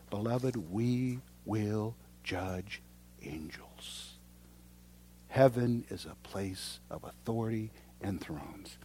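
slow, low and hushed pronouncement; solemn preaching. Acted. Preacher tells beloved congregation of heaven's thrones, solemn and hushed. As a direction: let each phrase sink in softly, low and slow.

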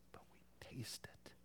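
hushed, breathy whisper, quick. Acted. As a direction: lean in and whisper it like a secret. Preacher whispering for emphasis.

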